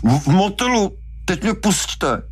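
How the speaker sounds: very high, stammering, choked confession; strangled. A narrator's reading. Hooper giving in under Brody's grip, strangled. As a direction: squeeze out the answer and beg release. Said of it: "Brody přitlačil".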